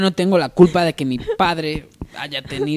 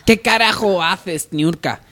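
louder, slow boss snapping; angry boss; 6 semitones higher, 7 dB louder, slower than usual. Acted. Boss scolding his secretary, angrily. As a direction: bark at the employee.

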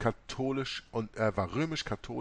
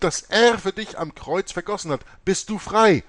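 louder, higher pleading preaching; fervent preacher; 7 semitones higher, 12 dB louder than usual. Acted. Rivera preaching to the nun, loud and fervent. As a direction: urge her toward salvation with rising fervor. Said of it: "Ich sagte".

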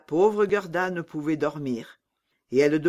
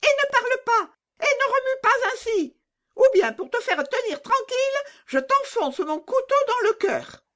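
very high, scolding little bandit; threatening robber girl. Acted. Robber girl threatening Gerda with a knife, shrieky-high and menacing. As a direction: threaten in a squeaky child voice.

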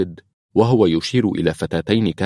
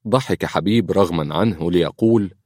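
usual speaking voice

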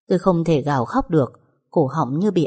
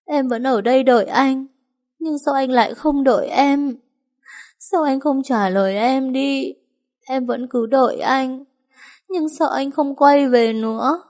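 higher, broken grieving question; weak tearful lament; 7 semitones higher than usual. A narrator's reading. Widow asking her dead lover in a high tearful voice. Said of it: "chỉ có thể phát ra thứ âm thanh yếu ớt".

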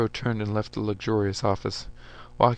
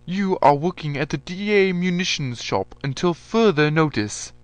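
loud, higher voice handing down a punishment; stern general; 7 semitones higher, 8 dB louder than usual. A narrator's reading. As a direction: general pronouncing a sentence, no argument.

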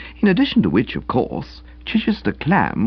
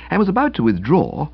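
ordinary delivery, nothing marked

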